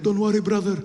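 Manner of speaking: lightly hammed Middle Eastern-accented reassurance; imagined ally. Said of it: "be like".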